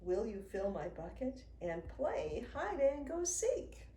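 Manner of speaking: ordinary delivery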